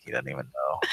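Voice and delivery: ordinary talk, nothing marked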